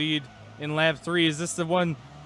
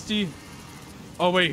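ordinary talk

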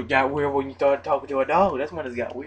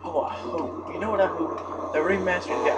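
ordinary delivery

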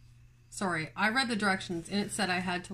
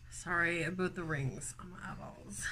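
whispered apology, voice off; whispered aside; 2 semitones lower, 6 dB quieter than usual. Real, not acted. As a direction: drop to a breathy-free whisper as an aside. Aside about her glasses.

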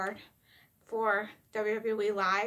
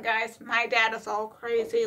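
ordinary delivery

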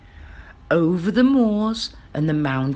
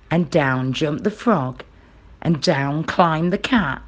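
plain reading voice

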